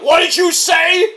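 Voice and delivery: high, slow, loud mock outrage; hammed-up confrontational shout; imitated yelling. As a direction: draw it out as an acted angry yell. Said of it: "starts yelling at him".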